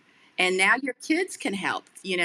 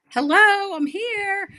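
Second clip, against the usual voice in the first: much higher and louder, indignant call for attention; exasperated hello; 13 semitones higher, 9 dB louder, slower than usual. Retold or imitated. To people who ignore her in her wheelchair, an indignant loud hello. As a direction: call out sharply to be noticed.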